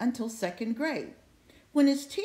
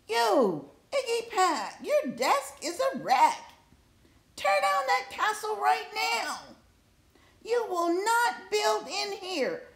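scolding teacher; 7 semitones higher than usual. A narrator's reading. Teacher scolding Iggy for building in class, high and sharp. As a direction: scold the boy like a furious teacher.

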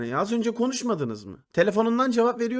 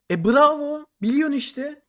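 loud, high mock applause; sarcastic bravo; 6 semitones higher, 5 dB louder than usual. Real, not acted. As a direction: sneer at her for asking what she already knows. Mocking girlfriend's pointless question.

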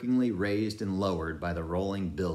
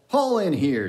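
command; 10 semitones higher, 9 dB louder than usual. A narrator's reading. Ahab orders.

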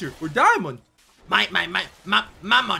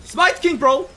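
ordinary talk

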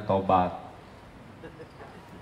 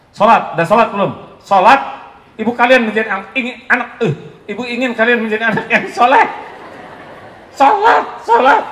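shrill high nagging mother, loud and fast; 11 semitones higher, 8 dB louder than usual. Retold or imitated. Preacher mimicking a mother nagging kids about prayer, loud and high. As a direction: mimic a mother shouting at her kids to pray.

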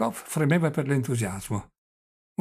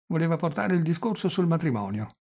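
ordinary delivery

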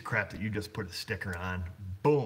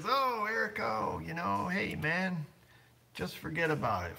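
imitating the customer; 9 semitones higher than usual. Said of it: "so the guy comes in and he says".

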